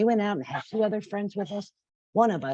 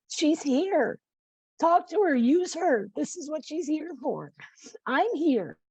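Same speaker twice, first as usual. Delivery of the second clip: angel voice; 9 semitones higher than usual. Acted. Voicing her angels and guides.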